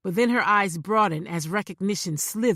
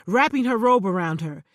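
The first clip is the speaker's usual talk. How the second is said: usual speaking voice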